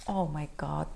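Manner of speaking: plain reading voice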